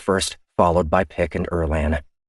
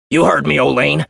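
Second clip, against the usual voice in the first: higher, louder hostile reply; defiant crewman; 8 semitones higher, 6 dB louder than usual. Acted. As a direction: challenge her openly. Otero defiant.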